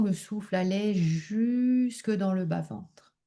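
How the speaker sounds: calm relaxation leading, unhurried and softened; guided meditation. Acted. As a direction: guide them gently into deep rest, phrase by phrase. Leads a yoga nidra relaxation.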